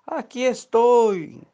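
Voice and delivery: sehr hoher, lauter Ruf nach oben; Schrei. Acted. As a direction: ruf dem Flugzeug verzweifelt nach. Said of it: "gritó".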